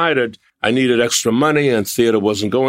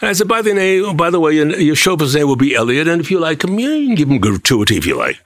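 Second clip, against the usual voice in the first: put-on high, bright English accent on the phone; 5 semitones higher than usual. Answering a Rolls Royce rental call in an English accent. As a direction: play the British agent Cooper taking a booking. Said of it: "put on an English accent to answer the phone".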